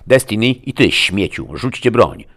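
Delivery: loud, high commanding shout; forceful sheriff's shout; shouted order. A narrator's reading. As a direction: order armed people to disarm. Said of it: "krzyknąłem".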